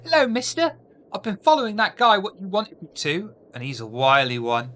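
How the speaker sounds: put-on high, loud eager street kid; bright child voice calling out to the mister; innocent child accent. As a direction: pipe up like an excited urchin. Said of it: "my best innocent child accent".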